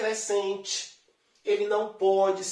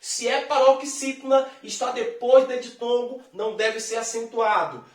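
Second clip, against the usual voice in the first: normal talking voice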